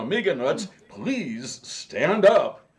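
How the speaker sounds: loud, slow, drawn-out announcer call; game show announcer. Retold or imitated. Host calls on the real Amiga Nuts, imitated game show announcer. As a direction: proclaim it like a quiz show host. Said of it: "Was it To Tell the Truth?".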